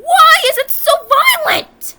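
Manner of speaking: high, loud exasperated yell; exasperated outburst. Real, not acted. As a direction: burst out in loud disbelief. Exasperated rant at kids' show violence.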